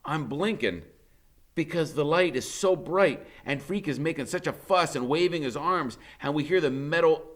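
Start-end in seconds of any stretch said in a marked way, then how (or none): none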